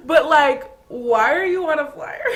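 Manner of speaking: high, loud burst of laughter into the question; laughing disbelief; laughing hard. Real, not acted. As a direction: crack up while asking. Laughing at flyer ick.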